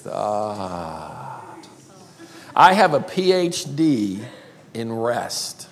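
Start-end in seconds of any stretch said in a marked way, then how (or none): 0.1-1.4 long, soft, low "ah" of relief; blissed-out falling sigh; contented sigh. Shown as a demonstration. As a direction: sink into a restful exhale. Relaxed sigh after saying rest.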